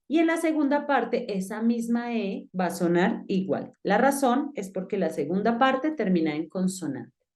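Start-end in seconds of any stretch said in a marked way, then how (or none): none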